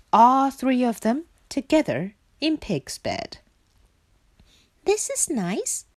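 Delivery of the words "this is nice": contented Frog. Acted. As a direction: sigh it high and cosy. Frog cosy and relieved.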